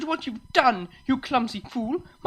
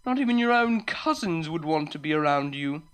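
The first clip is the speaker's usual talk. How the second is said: snobbish mockery; 7 semitones higher, 4 dB louder than usual. Unicorn belittling the farmer before a crowd, mocking. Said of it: "The snobbish unicorn only laughed and pointed".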